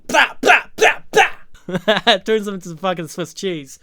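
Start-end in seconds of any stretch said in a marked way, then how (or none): 0.1-2.2 higher and louder mock gunshots; gunfire noises. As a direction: bark out gunshots like a cartoon gun. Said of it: "just goes".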